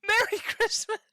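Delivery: high squeaky Merry Christmas; imitating Edgeworth. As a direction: echo the clip in a high voice. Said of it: "Oh, that's Edgeworth!".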